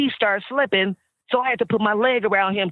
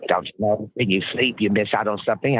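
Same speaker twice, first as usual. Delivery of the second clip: lower, lightly voiced [name]; a teasing [name], pitched down; imitating [name]; 7 semitones lower than usual. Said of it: "I'm doing this like him".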